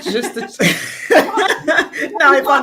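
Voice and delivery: high laughing protest. Real, not acted. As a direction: laugh while you answer. Host laughs at question.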